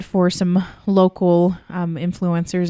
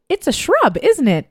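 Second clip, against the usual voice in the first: much higher, playful guess; proudly name the drink, pitched way up; mock-smug question, higher and a bit louder; knowing guess; 9 semitones higher, 3 dB louder than usual. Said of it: "And I was like".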